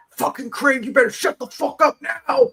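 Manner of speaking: high mock Southern snap at Craig; angry Southern accent. Mocking the streamer snapping at her husband in a Southern accent. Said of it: "she's like".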